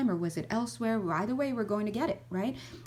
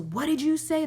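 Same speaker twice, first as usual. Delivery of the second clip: higher, louder snapping challenge; mock rage; 8 semitones higher, 5 dB louder than usual. Enraged person snapping at someone who asked for a pen, loud and sharp. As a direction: bark it back at the pencil borrower. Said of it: "you're like".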